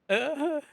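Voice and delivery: high bright burst of laughter; quick loud chuckle; real laugh. Real, not acted. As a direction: laugh at your own bad idea. Streamer laughs at own plan.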